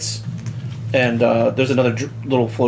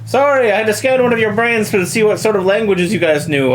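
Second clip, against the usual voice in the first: lightly voiced doctor; 5 semitones higher, 3 dB louder than usual.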